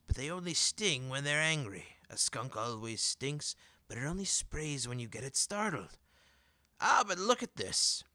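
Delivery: a salesman who smells a sale; eager merchant. Acted. Merchant's wheedling pitch.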